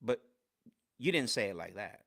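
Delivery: low, grave rebuke with weighty pauses; dead serious. Retold or imitated. Coach calling out a trainee in class, dead serious. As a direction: imitate a coach's deadly earnest correction. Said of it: "he looked at me dead serious".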